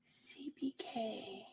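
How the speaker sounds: slow, barely audible whisper; hushed, drawn-out muttering; whispering to oneself. Real, not acted. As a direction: puzzle over the letters under your breath. Puzzling over letters to herself.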